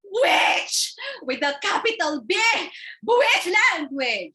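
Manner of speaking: high, loud shouted insult; offended sister shrieking insults; outraged shriek. Acted.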